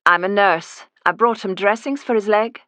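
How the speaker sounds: put-on disguised nurse explains her visit in an Edinburgh accent. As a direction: keep up the posh Scottish disguise. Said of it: "I do a passable Edinburgh accent".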